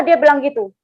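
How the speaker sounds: ordinary delivery, nothing marked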